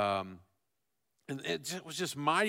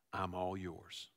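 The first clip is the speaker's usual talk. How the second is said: hushed, softer I'm all yours; soft prayer; 6 semitones lower, 11 dB quieter, slower than usual. Acted. Pastor voicing the congregation's quiet surrender, soft. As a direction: breathe it out like a private prayer.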